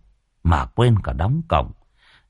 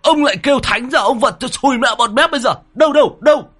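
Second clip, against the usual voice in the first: put-on much higher, louder blustering old man; blustering alarm; 14 semitones higher, 4 dB louder than usual. A narrator's reading. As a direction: bluster and threaten the burglar. Old man roused, hunting burglar. (ông già hùng hổ quát tháo)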